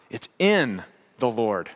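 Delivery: slow, low "It's in the Lord", leaning on "in"; weighty, drawn-out "in"; stress on IN. Real, not acted. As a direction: hold "in", pause, then "the Lord". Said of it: "I can't emphasize that word enough".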